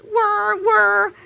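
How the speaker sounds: high whooshing whir, whir; whirring sound effect. Acted. Kid storyteller making the nunchuck whir sound. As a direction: voice the spinning undies as a high whir.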